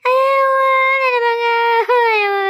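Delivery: loud, high wailing cry over her son; wailing mother. Acted. Mother discovers her boy won't wake, wailing loudly.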